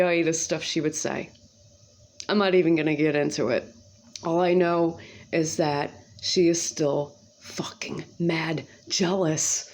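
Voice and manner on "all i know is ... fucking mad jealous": slow confiding whisper; hushed slow gossip whisper. Real, not acted. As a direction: lean in and whisper it slowly. Confiding about neighbor.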